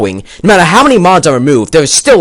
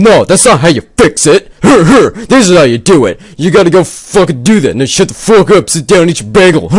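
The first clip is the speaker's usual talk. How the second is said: mocking impression of Gary; 4 semitones higher than usual. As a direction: lord it over him with a smug snigger. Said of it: "You come in saying".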